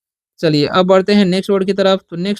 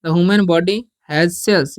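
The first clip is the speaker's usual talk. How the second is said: slow dictation; much slower than usual. Shown as a demonstration. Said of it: "या ऐसे बोल सकते हैं".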